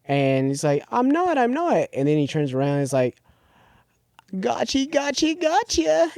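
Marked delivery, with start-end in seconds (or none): 0.9-1.9 high-pitched put-on boy voice; mock protesting brother. Brother Noah denying he'll wear the mask, high mock protest. 4.4-6.1 high-pitched, put-on taunt; taunting masked brother. Brother turning round in the mask, high mock taunt.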